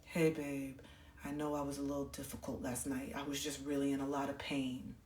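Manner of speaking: very soft, low voice of a hurting father; hushed, weary confession of pain; subdued apology. Retold or imitated. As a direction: apologise quietly from a sickbed. Dad apologising from hospital bed, retold.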